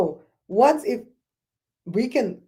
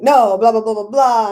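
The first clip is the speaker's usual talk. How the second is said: dismissive blah blah; 8 dB louder than usual. Retold or imitated. Mocking friend's dismissive reply.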